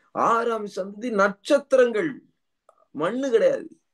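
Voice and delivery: impassioned preaching. Real, not acted. Preacher declares believers a new creation, impassioned preaching. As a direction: proclaim it high and with conviction.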